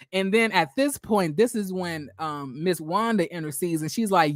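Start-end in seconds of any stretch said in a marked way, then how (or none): none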